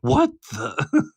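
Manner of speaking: high, startled "what the"; a sudden jump up in pitch; double take. Retold or imitated. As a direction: caught off guard mid-sentence. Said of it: "I did a double take".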